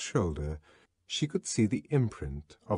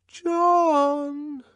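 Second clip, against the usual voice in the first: small ingratiating voice; 6 dB louder than usual. Acted. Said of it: "ventured a small, ingratiating voice".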